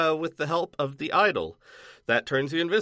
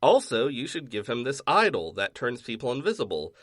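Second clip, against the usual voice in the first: normal talking voice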